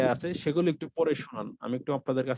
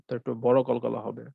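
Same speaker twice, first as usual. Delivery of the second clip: ordinary delivery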